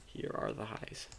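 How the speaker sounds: breathy whisper under breath. Real, not acted. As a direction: whisper it to yourself. Whispered repeat.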